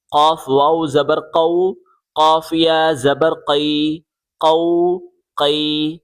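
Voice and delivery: full, heavy q far back in the mouth on "قاف", "قو", "قی"; thick qaf. Shown as a demonstration. Teacher repeating for pupils, heavy qaf. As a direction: thicken every q in "قاف واو زبر قو". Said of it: "اسے موٹا کر کے پڑھیں گے".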